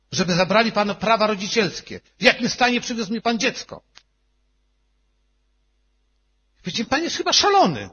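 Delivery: a hint of outrage at the doctor's threat; retold heated argument. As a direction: push back hard at the doctor.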